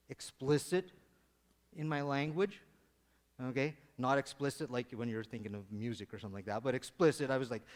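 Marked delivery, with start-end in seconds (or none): none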